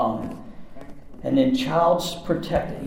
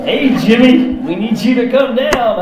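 higher and louder, calling him down; police calling out; 9 semitones higher, 7 dB louder than usual. Retold or imitated. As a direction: call up to him like a cop outside.

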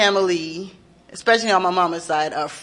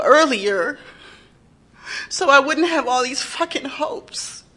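anguished plea to God; 5 semitones higher than usual. Retold or imitated. Grieving mother questioning God.

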